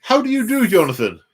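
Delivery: hammed-up posh English gent greeting; posh English accent. Teasing co-host by aping his English accent, mock posh English. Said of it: "I'm not trying to do your accent".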